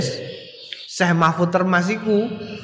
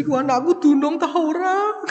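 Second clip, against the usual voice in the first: high, put-on tearful whine; mock crying pilgrim; 12 semitones higher than usual. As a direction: play the pilgrim sobbing over his rice like a child. Mimicking pilgrim crying over rice.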